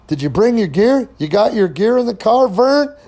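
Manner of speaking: playful mock-serious asking, pitched high; teasing question. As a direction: rib him with a rising question, climbing to the name. Retold teasing question to Vern.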